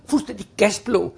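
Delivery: ordinary delivery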